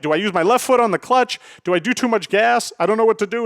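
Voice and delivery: high-pitched, overwhelmed pleading questions; mock near-tears panic. Daughter being taught to drive by dad, mock tearful panic. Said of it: "she's starting to cry".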